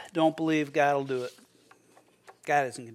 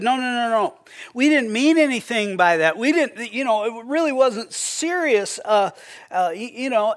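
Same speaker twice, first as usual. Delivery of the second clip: higher, louder hurried protest; flustered denial; 5 semitones higher, 4 dB louder than usual. Retold or imitated. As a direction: backpedal nervously, pitched up. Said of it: "And the pastor said".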